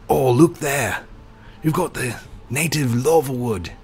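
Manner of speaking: Aussie wildlife presenter pointing things out; Australian accent. Acted. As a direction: wildlife guide showing off the animals in a mild Australian accent. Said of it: "I've lost the accent".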